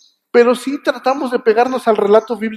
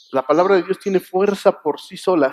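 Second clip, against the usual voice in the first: ordinary delivery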